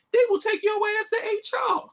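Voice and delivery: high, fast indignant yell; outraged shout. Real, not acted. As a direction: blurt it out in disbelief. Outraged punchline.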